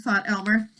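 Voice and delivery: ordinary delivery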